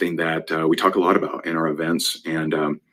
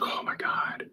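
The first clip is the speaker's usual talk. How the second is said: retold crying; 13 dB quieter, slower than usual. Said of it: "And she's just crying".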